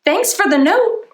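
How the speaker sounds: high cheerful thanks; light boy voice; grateful kid. Acted. Justin thanking Brian for the note, high cheerful.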